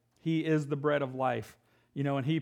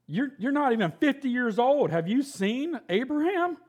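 much higher, louder, mocking incredulity; a scornful crowd voice, higher and louder, jeering at the claim; scoffing disbelief; 10 semitones higher, 5 dB louder than usual. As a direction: sneer it like the crowd can't believe him.

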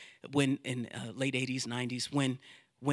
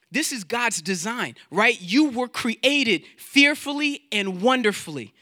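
ordinary delivery

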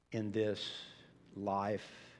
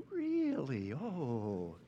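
mock pleasant surprise; 3 semitones higher, much slower than usual. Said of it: "ooh, warm and fuzzy".

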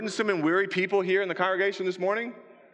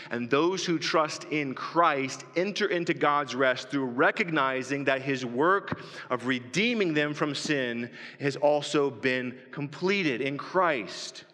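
ordinary talk

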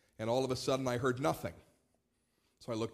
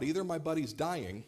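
ordinary talk

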